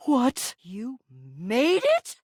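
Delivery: slow, swooping disbelief; incredulous. Acted. Reacting to who made the sword, incredulous. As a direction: stretch it out, rising sharply at the end.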